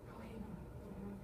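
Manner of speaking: very soft, low mumble; drunken mumble. Acted. Drunk to himself on the dirt road, hushed. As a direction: mumble it under your breath.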